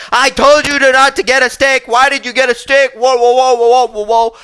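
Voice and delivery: high, loud bratty tantrum voice; throwing a fit. Retold or imitated. Little brother throwing a fit over the extra sticks, high loud tantrum. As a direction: scream it like a kid in a tantrum. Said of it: "started throwing a fit again".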